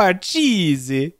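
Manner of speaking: drawn-out, hammed-up Italian accent; mock Italian, every syllable lingered over; stupid Italian accent. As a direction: stretch it out in a silly Italian accent. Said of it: "In my stupid Italian accent".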